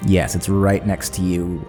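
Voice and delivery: normal talking voice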